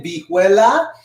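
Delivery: vihuela as "bee-WEH-la", rising; Spanish pronunciation. Shown as a demonstration. Teacher asks class to say it, Spanish pronunciation. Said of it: "try to say that again".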